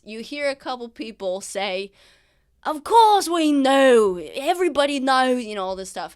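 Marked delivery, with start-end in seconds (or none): 2.6-5.4 thieves yelling. Acted. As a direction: yell back like an exasperated crowd. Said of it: "the thieves yelling noises".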